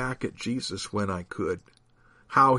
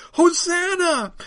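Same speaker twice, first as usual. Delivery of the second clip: high, drawn-out loud cry; shouting; 5 dB louder, slower than usual. A narrator's reading. Crowd pouring out toward Jesus, high shout. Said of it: "pointing at Jesus and shouting".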